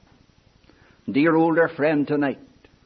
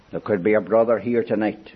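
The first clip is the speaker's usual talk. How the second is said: usual speaking voice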